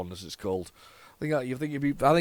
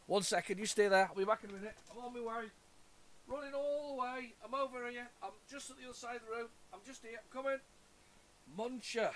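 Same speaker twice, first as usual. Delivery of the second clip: higher, softer pretend voice; a soft, higher voice as if narrating a little errand; playful pretend running commentary; 8 semitones higher, 11 dB quieter than usual. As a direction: play-act dashing off to fetch it. Pretend errand to fetch the game.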